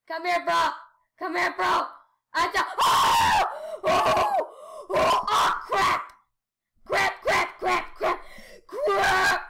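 loud, high panicked shouts; real gaming frenzy; frantic yelling. Real, not acted. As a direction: yell through a close call. Frantic play, reaching number five.

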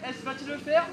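sports commentary. Acted. Commentates the attempt.

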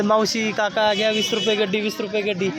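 loud, high hawker's cry; vendor's call. Shown as a demonstration. Father showing his son how to hawk vegetables. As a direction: shout the price to passers-by. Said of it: "त्यांना".

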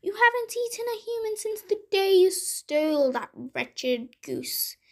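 high-pitched scolding wife voice; giant's wife, chiding. A narrator's reading. Wife to the giant about his wretched goose, high-pitched chiding. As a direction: pitch it up like a nagging wife, easing down at the end.

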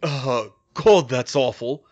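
loud gasp of disgust; gasping reaction. A narrator's reading. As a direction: gasp it out after a foul drink. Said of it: "Harry gasped".

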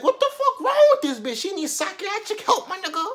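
very high, incredulous rant; outraged disbelief. Real, not acted. Storyteller appalled at the teacher's taser threat, high incredulous outburst.

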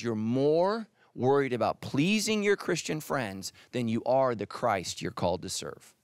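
ordinary talk, nothing marked

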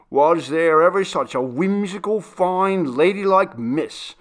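high, loud, sneering mimicry of a fine lady; mocking demand. The butler railing at Maria in the kitchen, sneering. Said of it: "demanded Daniel".

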